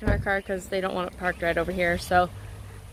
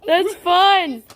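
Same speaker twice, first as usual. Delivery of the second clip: reassuring parent; 8 semitones higher, 6 dB louder than usual. Real, not acted. Parent soothing scared child.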